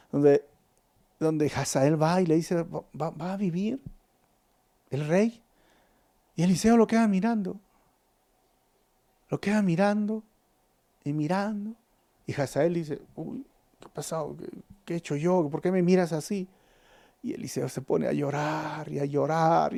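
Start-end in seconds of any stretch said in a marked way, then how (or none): 2.6-5.4 softer, stumbling over the first words; timid stuttering question to the prophet; nervous stammer. Retold or imitated. Hazael nervously asks Eliseo. 13.1-16.5 much softer, startled worried questions; hushed alarm when the prophet stares at him. Retold or imitated. Hazael unsettled by Eliseo's stare.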